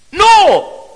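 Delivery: emphatic no. Real, not acted. Preacher's emphatic denial.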